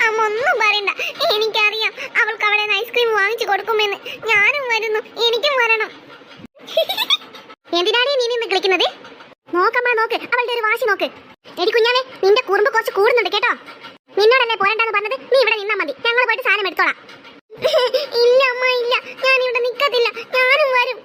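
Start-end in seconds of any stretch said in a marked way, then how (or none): 0.1-5.9 sulky, sobbing insistence; crying child. Acted. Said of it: "നീ ഇങ്ങനെ കരയണത്". 18.2-21.0 wailing, stubborn refusal; crying child. Acted. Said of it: "ഇജ്ജെന്തിനാ ഇവിടെ കരയണത്".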